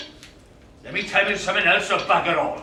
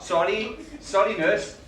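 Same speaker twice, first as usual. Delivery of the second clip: ordinary talk, nothing marked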